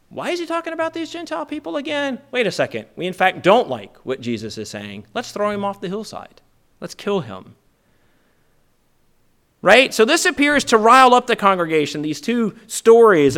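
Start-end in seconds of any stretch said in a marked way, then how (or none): 0.1-7.6 a light impression of an indignant mob; crowd turning hostile. Nazareth congregation turning on Jesus, lightly voiced indignant mob.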